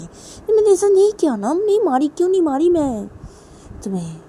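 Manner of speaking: high, loud burst of protest; indignant outburst. Acted. Arguing back over not having slapped, indignant. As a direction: protest hotly, voice pitched right up.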